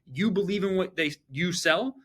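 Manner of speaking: ordinary delivery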